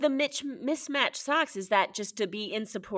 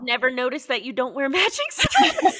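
louder, laughing through the words; real laugh, louder than her usual talk; 8 dB louder, faster than usual. Real, not acted. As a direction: laugh while confessing it. Laughs at missing the socks.

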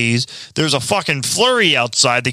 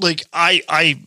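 ordinary talk

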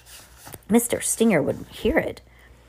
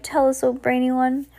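higher-pitched crowd plea; eager cry; 7 semitones higher than usual. A narrator's reading. Witches begging their brainy leader for more, high eager cry. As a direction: beg with a touch of excitement. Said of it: "they cried".

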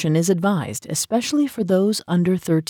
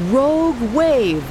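yelled warning from up high; shouting from crow's nest; 6 semitones higher, 4 dB louder, slower than usual. Acted. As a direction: shout it down from the mast. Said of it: "a sailor's voice cried from on high".

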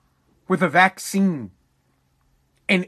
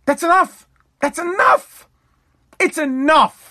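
heated insistence; 6 semitones higher than usual. Real, not acted. Ranting about double voting.

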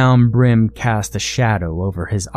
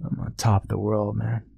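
mumbled; 8 dB quieter than usual. A narrator's reading. Alone on his couch, Blaine mutters bitterly to himself. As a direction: mutter it under the breath, defeated. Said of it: "he mumbled to himself".

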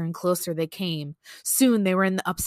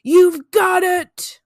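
sudden loud, high, drawn-out yell; big campfire-story scare; jump-scare shout; 10 semitones higher, 10 dB louder, much slower than usual. Acted. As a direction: pounce on the listener with the punchline. Jump-scare punchline of scary story.

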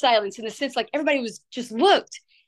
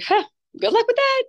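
sarcastic; 12 semitones higher, 3 dB louder than usual. Retold or imitated. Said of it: "I'm like".

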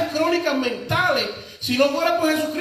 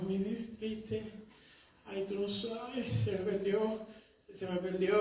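much softer, hammed-up moaning; whiny lament; 3 semitones lower, 13 dB quieter than usual. Preacher parodying a sad social media comment in a soft whine. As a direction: pout and whimper the loss.

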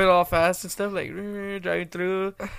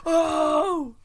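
mock pained cry; 9 semitones higher than usual. Retold kids crying out in pain.

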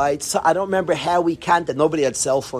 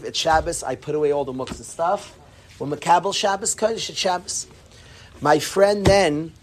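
ordinary talk, nothing marked

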